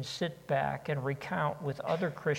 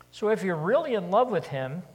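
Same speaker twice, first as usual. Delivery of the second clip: preaching at full force; 5 semitones higher, 7 dB louder, faster than usual. Real, not acted. Preacher urging the congregation, loud and quickening. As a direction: raise the voice and push forward faster.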